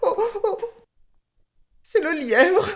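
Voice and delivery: high-pitched sobbing warthog; put-on weepy whine; tearful tattling. A narrator's reading. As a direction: snivel and whimper to the king. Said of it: "en pleurant davantage".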